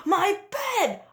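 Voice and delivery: high, loud sputter of shock. A narrator's reading. Papa Bear sees his bed, loud sputter. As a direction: blurt it out in outrage. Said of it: "Papa Bear sputtered".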